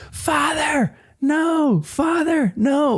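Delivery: high, drawn-out cry at a contained volume; Barty Crouch Junior screaming. Acted. Fan pitching an opening where someone is heard screaming, high contained scream. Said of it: "you just hear someone screaming".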